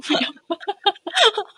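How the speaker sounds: high laugh. Real, not acted. Laughing at absurd idea.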